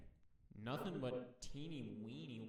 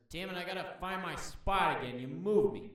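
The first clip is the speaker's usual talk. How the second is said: annoyed snake; 7 semitones higher, 9 dB louder than usual. Pretend. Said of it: "There's two versions of this posture".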